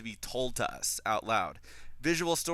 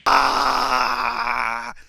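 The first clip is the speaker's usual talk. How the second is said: long, loud high-pitched scream; dismayed scream; 13 semitones higher, 11 dB louder than usual. Retold or imitated. Reenacts dismay at Caspian's line.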